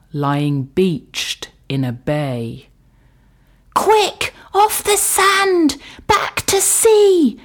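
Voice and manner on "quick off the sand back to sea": high, loud urgent snail; shrill snail calling for help; urgent snail cry. Acted. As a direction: cry out like a tiny frantic snail. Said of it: "cried the snail".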